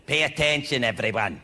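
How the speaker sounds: lightly voiced nasty teacher lecturing slowly; science teacher. Teacher presenting toads to the class, mildly gruff.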